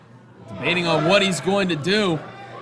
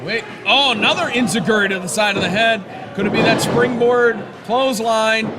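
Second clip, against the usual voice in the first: wrestling commentator; 2 semitones higher than usual. Real, not acted. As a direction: call every move as it lands. Live play-by-play of wrestling match.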